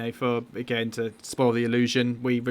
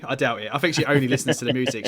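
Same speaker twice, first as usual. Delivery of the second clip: ordinary talk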